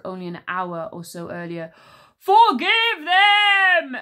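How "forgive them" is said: high, loud, very slow booming roar; giant's roar. Acted. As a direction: bellow like a giant's dying breath. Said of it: "roared the giant's last breath".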